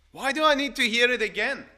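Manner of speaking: loud, high protest; mock complaint. Preacher voicing someone tired of hearing God's word, loud protest. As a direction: protest loudly as a grumbling listener.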